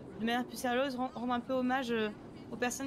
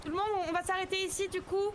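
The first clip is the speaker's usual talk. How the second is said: higher, quicker announcement to a group; calling out; 6 semitones higher than usual. Acted. March organizer tells everyone they stop here, calling out. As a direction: raise the pitch to halt the crowd.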